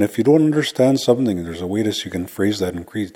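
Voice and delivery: ordinary delivery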